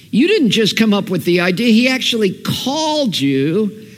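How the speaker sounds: ordinary delivery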